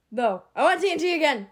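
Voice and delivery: loud, fast whiny demand. Real, not acted. Player demanding TNT loudly.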